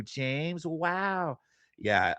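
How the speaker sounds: ordinary talk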